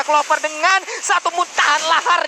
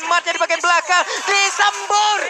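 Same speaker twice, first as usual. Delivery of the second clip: excited play-by-play; 5 semitones higher than usual. Real, not acted. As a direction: ride the fight's peak, pitch up. Caster hypes intense teamfight.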